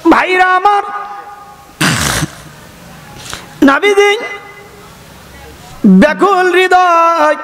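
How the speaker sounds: very high chanted phrases with long pauses; soaring sermon cry. Acted. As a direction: cry out to the congregation in high song-like bursts. Preacher's soaring address to congregation. (উঁচু সুরে আবেগঘন ডাক)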